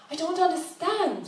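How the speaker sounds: lightly voiced confused traveller; asking in dismay; puzzled young woman. Woman questions the old lady.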